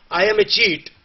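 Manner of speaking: role-plays the cheat, pitched high; cheater role. Pretend. Plays a cheating plant owner.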